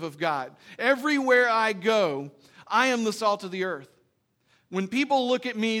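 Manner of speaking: full-force preaching. Acted. Pastor urging believers to be salt, loud full-force preaching. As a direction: preach it hard to the pews.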